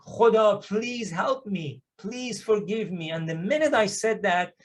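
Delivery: normal talking voice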